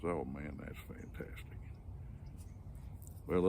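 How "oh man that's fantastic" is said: soft, breathless amazement; half-whispered, taken in completely; the fooled young engineer marvels quietly at the boss's tall tale; hushed awe. Retold or imitated. Gullible boy amazed by the joke.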